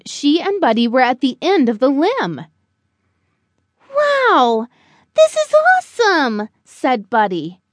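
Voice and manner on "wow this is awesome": high, thrilled little-bird voice; excited young bird. Acted. Buddy to Mother out on the limb, thrilled. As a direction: squeal it with wide-eyed delight, much higher.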